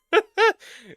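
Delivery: loud high burst of laughter. Real, not acted. Laughs at own dismay.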